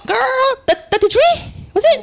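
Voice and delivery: squealing high, half crying laugh. Real, not acted. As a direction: squeal it out in disbelief. Squeals correcting her age.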